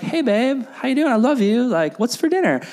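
light everyday hello to your spouse; casual chat with wife. Retold or imitated. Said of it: "I'm like".

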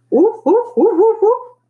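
high, loud hooting woofs; dog's horn-like bark. A narrator's reading. Girl demonstrates how the dog sounded, hooting barks. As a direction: hoot like a big loud horn. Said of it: "Deli demonstrated".

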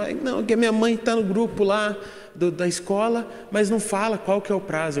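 mock excuse-making, pitched well up; Nicolas. Brother blaming mum for the missed deadline, high mock excuse. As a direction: mimic a whiny kid passing the blame. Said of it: "começou a colocar a culpa na minha mãe".

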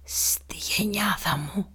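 slow, soft whisper into the ear; a dying man's last whisper. Acted. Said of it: "του ψιθύρισε στο αυτί". (ψίθυρος στο αυτί)

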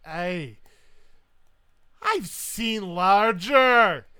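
loud, slow, high brazen verdict; Highland wife. Acted. Small wife looks up at the captain, loud brazen challenge. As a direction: size him up in a booming Scottish voice. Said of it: "and she'll go, like".

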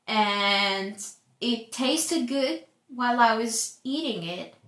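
ordinary talk

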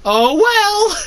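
high loud exclaiming voice; exclaiming Luke. Acted. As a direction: blurt it out in a high voice. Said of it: "he exclaimed out loud".

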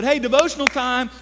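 put-on calling the kids. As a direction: summon the kids cheerfully. Father calls kids to table.

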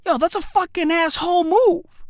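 usual speaking voice

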